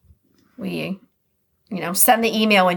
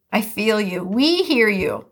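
normal talking voice

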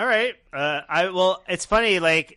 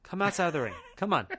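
fast, coaxing cop chant; mock police coaxing, quick and repetitive; imitating the detectives; 3 semitones higher, much faster than usual. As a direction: rattle it off like cops coaxing someone out. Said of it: "they're just going".

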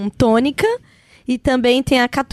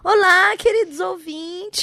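slow, drawn-out mock spooky greeting; voice of death; 6 semitones higher, slower than usual. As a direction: drag out the greeting like doom. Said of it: "A voz da morte!".

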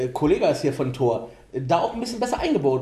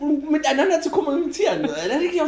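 incredulous; 11 semitones higher than usual. Real, not acted. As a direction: rant in disbelief. Incredulous rant.

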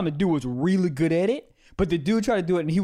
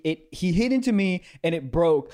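ordinary talk, nothing marked

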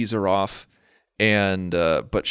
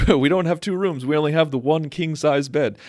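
sitcom clerk; 6 semitones higher than usual. Retold or imitated. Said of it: "sitcom-y thing".

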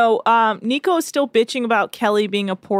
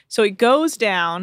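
ordinary talk

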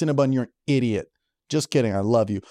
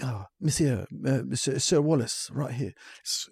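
put-on posh waiter accent; 2 semitones lower, 8 dB quieter, slower than usual. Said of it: "What was that accent?".

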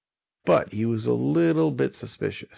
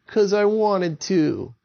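much higher, louder, slow taunt; defiant; 10 semitones higher, 5 dB louder, slower than usual. Acted. Avon brushing off Jerry the Giant, defiant slow taunt. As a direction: spit it back defiantly.

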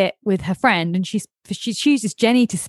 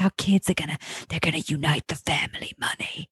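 hushed conspiratorial whisper; scheming whisper; 5 semitones lower, 7 dB quieter than usual. Retold or imitated. Mocking the parents' scheme to co-host, in a whisper.

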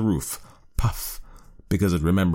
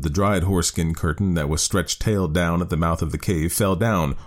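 plain reading voice

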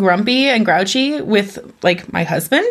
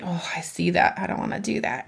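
put-on much softer, sulky childish mumble with a laugh; five-year-old; 2 semitones lower, 9 dB quieter than usual. Mom acting her inner five-year-old, soft and sulky. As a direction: mumble like a reluctant little kid. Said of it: "like a five-year-old".

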